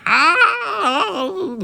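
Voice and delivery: high long falling scream; tiny cowboy screaming as he falls. A narrator's reading. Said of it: "screaming all the way down".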